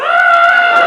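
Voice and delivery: very high, very loud Ah!; a sudden high, loud cry; shout. Shown as a demonstration. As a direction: burst out with a big Ah!. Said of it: "bring this like this and go".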